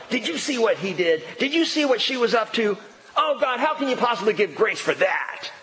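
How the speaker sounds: high-pitched, lightly acted accuser; accusing prosecutor. Prosecutor Satan accusing believers before God.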